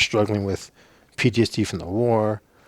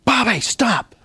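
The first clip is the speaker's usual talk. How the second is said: much higher sharp yell of "stop"; urgent shout; 13 semitones higher, 4 dB louder, slower than usual. Retold or imitated. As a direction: stop him dead with your voice. Shouting warning at teammate.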